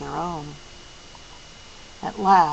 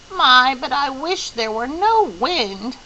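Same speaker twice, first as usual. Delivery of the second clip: slow, higher, a little lament; plaintive; 7 semitones higher, 4 dB louder, slower than usual. Acted. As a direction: sigh out the complaint. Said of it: "Missus Watson said plaintively".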